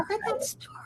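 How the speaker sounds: awed whisper. Real, not acted. Host confides she never heard it, awed whisper. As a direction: breathe it out in disbelief.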